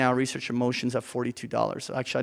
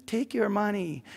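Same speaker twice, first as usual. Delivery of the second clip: high, soft little birdie voice in your ear; a tempting birdie murmuring advice, pitched up; little birdie whispering; 7 semitones higher, 5 dB quieter than usual. Pretend. A birdie whispers to the investor: high, soft coaxing voice. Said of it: "this little birdie starts whispering in your ear".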